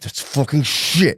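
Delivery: slow, drawn-out mock outrage; slowly dawning exasperation; mounting disbelief. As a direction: stretch it out as the anger dawns. Acts out stages, escalating to anger.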